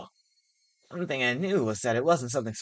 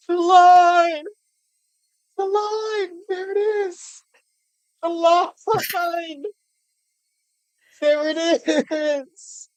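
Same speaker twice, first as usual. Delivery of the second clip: high, loud fan screaming; ecstatic shouting; 10 dB louder, much slower than usual. Real, not acted. Player celebrating the awaited line, high excited shouts. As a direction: shriek in triumph at spotting it.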